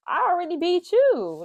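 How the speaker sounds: high, loud triumphant retort; gloating comeback. Retold or imitated. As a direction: throw it back at him, pitch up and punchy. Gloating retort after boy's taunt.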